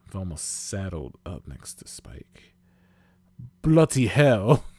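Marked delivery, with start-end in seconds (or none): 3.6-4.6 loud, drawn-out British curse; high, slow British outburst; British exclamation. A narrator's reading. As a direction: exclaim in a put-on British accent. British Spike swears, startled.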